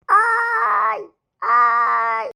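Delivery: drawn-out cry of pain; boy's mock cry of pain. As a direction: yelp at the needle. Boy gets the injection.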